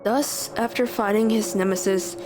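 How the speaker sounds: ordinary delivery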